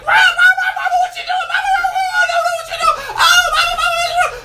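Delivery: very high, loud frantic cries; falling and screaming for mom; panicked baby eagle. Acted. Eaglet falls off Mama's back, very high panicked cries.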